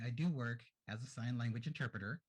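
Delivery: usual speaking voice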